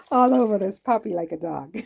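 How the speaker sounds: cracking herself up mid-sentence; laughing. Real, not acted. Laughing through cooking joke.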